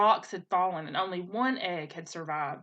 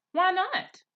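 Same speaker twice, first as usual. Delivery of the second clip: put-on indignant young gosling; 11 semitones higher, 4 dB louder than usual. Gosling argues with his mother.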